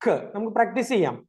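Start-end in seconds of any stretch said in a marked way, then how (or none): 0.1-0.2 short isolated "ഖ", a k sound; letter C sound. Shown as a demonstration. Teacher explaining C's sound to children, short k-like "ഖ". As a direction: say "ഖ" as C sounds, not its name. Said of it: "സിയുടെ sound".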